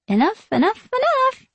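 high, sing-song mimicry; gleeful, lilting echo; chiming. A narrator's reading. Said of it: "chimes Sarah".

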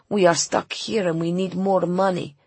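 ordinary delivery

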